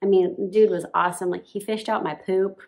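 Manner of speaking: ordinary talk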